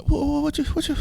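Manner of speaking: high-pitched, flustered stammer; stuttering. Shown as a demonstration. Grandson hit in the heart by granddaddy's words, stuttering. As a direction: get stuck repeating the words, squeakily. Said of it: "when you start stuttering".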